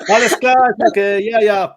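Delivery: high, loud, laughing German DJ. Retold or imitated. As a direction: laugh through it like the bar DJ. DJ voice continues, laughing.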